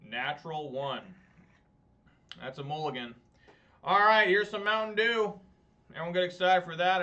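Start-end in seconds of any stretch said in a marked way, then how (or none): 3.8-7.0 mock hype. Mock-hyping a Mountain Dew.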